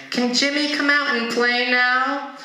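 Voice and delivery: put-on high-pitched little kid voice. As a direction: plead like a child at a friend's door. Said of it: "he feels like a kid coming in".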